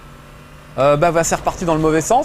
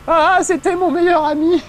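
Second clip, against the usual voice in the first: high, slow mock alien wail; hammed-up high alien cry of loss; Covenant alien; 10 semitones higher, 3 dB louder, slower than usual. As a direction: squeal it like a grieving little alien soldier. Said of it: "il y en a un qui dit".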